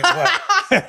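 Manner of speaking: "What?" squeaked high through laughter; mock the guy's challenge while cracking up; laughing mock "What?". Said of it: "He goes".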